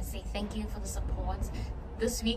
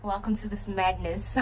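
ordinary talk

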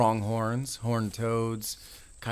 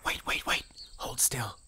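hushed urgent whisper; 6 dB quieter than usual. Acted. Ranger hushing her friend near a crow, whispering. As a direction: whisper so the bird stays put.

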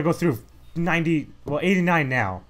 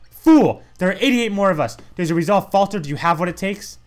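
loud, much higher bark of Fool; booming gatekeeper taunt; 6 semitones higher, 5 dB louder than usual. Acted. Gate guardian taunting the challenger, booming. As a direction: shout down the challenger.

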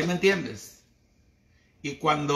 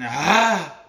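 rising higher, louder mock voice, then dropping off; mock tough woman; 7 semitones higher, 5 dB louder than usual. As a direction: ham up the woman who just shrugs and starts over. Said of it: "Y se reinventan y dicen". (voz burlona de mujer aguerrida, más aguda y fuerte)

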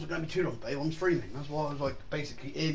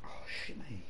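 pained whisper under breath; 6 semitones lower, 9 dB quieter than usual. Real, not acted. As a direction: hiss it in a whisper. Soap stinging his eye.

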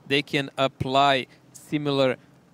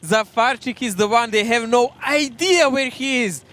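excited; 9 semitones higher, 6 dB louder than usual. Real, not acted. Caster hyped during clutch moment.

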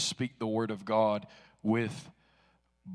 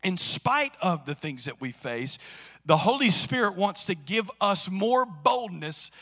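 full-force preaching; 7 semitones higher, 11 dB louder than usual. Acted. Pastor rousing the congregation about opposition, full-force preaching.

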